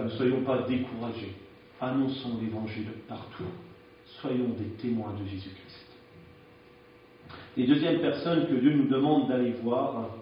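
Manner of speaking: normal talking voice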